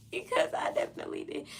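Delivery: laughing through the words; admitting it with a laugh. Real, not acted. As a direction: giggle it out, embarrassed. Laughing at own mistake.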